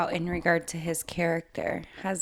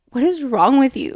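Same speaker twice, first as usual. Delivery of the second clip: higher, louder incredulous outburst; mock exasperation; 5 semitones higher, 9 dB louder than usual. Reader voices exasperation at the gargoyle, mock incredulous. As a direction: throw it out as an exasperated demand.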